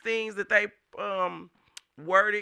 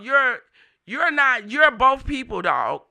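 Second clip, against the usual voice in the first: higher and louder accusation; angry retort; 4 semitones higher, 6 dB louder than usual. Retold or imitated. Retelling how he told off the hacker, angry and loud. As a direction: hit him with it, louder.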